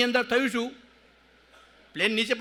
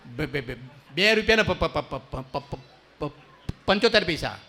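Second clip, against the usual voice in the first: stuck on બે and પ- repeats, low; mock stammer; 6 semitones lower, slower than usual. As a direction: stammer out the price. Shopkeeper stammers price.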